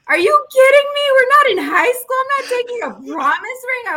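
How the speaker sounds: high, loud indignant outburst; furious retort. Retold or imitated. Wife refusing the promise ring, high angry outburst. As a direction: fire it back at him, outraged. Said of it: "I was so mad".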